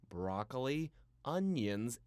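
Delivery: plain reading voice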